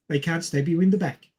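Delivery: usual speaking voice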